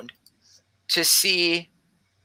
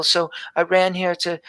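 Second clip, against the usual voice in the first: ordinary talk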